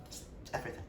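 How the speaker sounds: whispered, lost for words; barely voiced, overcome; breathless whisper. Real, not acted. Booktuber overcome by a favourite book, whispering.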